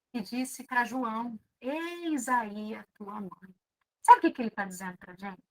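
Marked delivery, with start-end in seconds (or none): 1.6-3.6 quiet and slow, with tenderness; soft, slow reading. Shown as a demonstration. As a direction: let it fall softly and slowly. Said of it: "Agora vamos fazer de novo?". (devagar e baixinho, com ternura)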